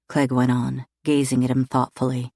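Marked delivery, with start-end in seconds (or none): none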